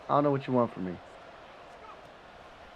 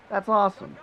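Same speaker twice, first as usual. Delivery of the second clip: excited exclamation; 9 semitones higher, 5 dB louder than usual. Real, not acted. Excited about timeout.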